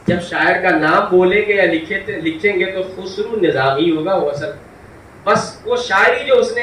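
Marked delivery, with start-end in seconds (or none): none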